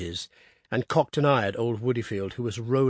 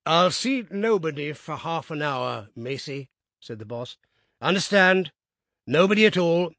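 firm order; 5 semitones higher than usual. A narrator's reading. Boss ordering messenger.

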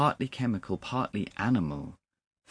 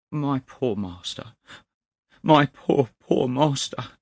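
high, grieving lament; tearful cry; 6 semitones higher, 6 dB louder than usual. A narrator's reading. Clerk cries over his dead master, tearful and grieving. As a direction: cry it out, louder on the repeat. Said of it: "the tears were running down".

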